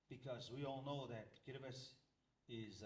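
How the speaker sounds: ordinary talk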